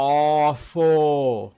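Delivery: ordinary talk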